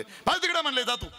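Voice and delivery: high, sharp shooing; irritated dismissal. Acted. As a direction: send him packing as a harried organiser. Committee shoos the herder away.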